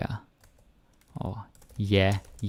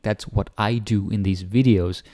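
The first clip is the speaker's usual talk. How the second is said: ordinary talk, nothing marked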